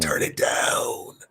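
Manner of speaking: slow whispered command; hushed urgent command. Retold or imitated. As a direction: whisper it slowly. Telling friend to lower gain.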